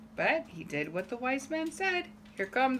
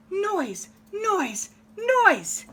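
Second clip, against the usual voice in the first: frustrated farmer; 9 semitones higher, 5 dB louder, much slower than usual. Acted. Fed-up farmer complains.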